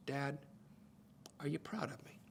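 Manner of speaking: put-on soft, half-whispered child's question; hushed plea. Little boy asks his dad for approval, hushed. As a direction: let it drop to a tender near-whisper. Voicing a boy's heartfelt craving.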